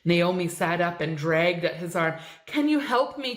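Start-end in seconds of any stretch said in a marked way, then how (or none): 2.5-3.4 imploring gasp. A narrator's reading. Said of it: "she gasped imploringly".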